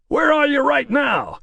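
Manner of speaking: high, slow American call; an American producer calling down the line. Acted. Producer asking Toby where he is, high American call. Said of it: "work a lot harder on that American accent".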